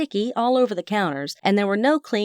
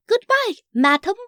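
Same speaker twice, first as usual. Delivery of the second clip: high, hammed-up British accent; mock-posh British, pitched way up; bad British accent; 11 semitones higher, 4 dB louder than usual. A narrator's reading. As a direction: bid farewell in badly faked British. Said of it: "in a really bad British accent".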